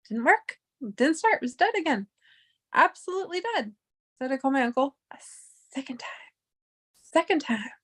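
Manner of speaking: high-pitched, hushed exasperation; exasperated disbelief. Real, not acted. Recounts car dead a second time.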